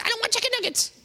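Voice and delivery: put-on very high childish whine; high-pitched kid demanding chicken nuggets; whiny child. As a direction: chant it like a kid begging. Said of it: "Your kids go".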